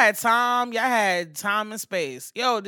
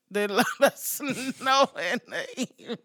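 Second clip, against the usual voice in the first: ordinary delivery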